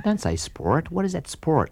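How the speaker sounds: rising disbelieving objection; incredulous. Retold or imitated. [name] arguing with backing singers, incredulous.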